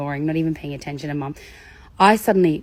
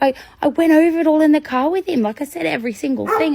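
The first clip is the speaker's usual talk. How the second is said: higher, louder indignant protest; exasperated parent; 9 semitones higher, 4 dB louder than usual. Retold or imitated. Parent complaining to the speaker, higher and indignant. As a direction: insist you did everything right.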